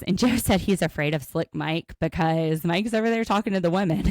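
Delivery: ordinary delivery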